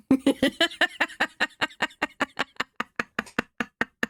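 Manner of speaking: a high, soft giggle; soft laugh. Real, not acted. Streamer to chat, giggling at her own wish. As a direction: giggle at herself.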